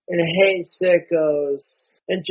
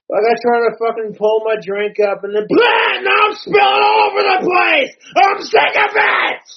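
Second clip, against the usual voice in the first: much higher, louder enraged yelling; furious shout; 11 semitones higher, 8 dB louder than usual. Real, not acted. Spilling his drink again, a furious high shout. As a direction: blow up at the spill.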